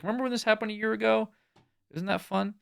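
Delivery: mock social-media reminder, pitched high; imitating Facebook reminder. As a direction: pitch it up like an automated memory prompt. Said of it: "like how Facebook keeps track of your memory".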